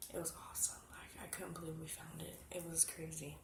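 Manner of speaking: soft near-whisper of disbelief; hushed awe. Real, not acted. Witch recalls finding the altar, hushed awe. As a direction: drop to an awed hush.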